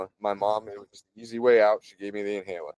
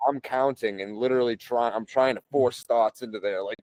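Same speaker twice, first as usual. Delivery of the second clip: ordinary talk, nothing marked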